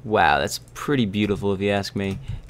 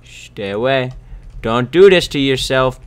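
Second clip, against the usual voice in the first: normal talking voice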